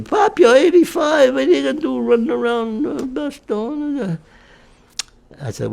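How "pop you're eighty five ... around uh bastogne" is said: family hollering. Retold or imitated. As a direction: holler it like worried kids. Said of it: "everybody starts hollering".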